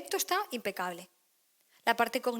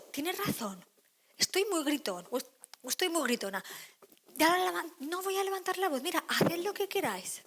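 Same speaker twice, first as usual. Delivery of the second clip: promise; 7 semitones higher than usual. Retold or imitated. Parent promising not to shout.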